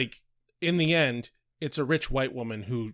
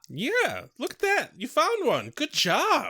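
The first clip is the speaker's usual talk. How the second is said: high-pitched mock cheer; mock congratulations; 12 semitones higher, 4 dB louder than usual. Co-host mock-praising the find of a cop, high mock congratulations. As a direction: congratulate them in an over-keen high voice.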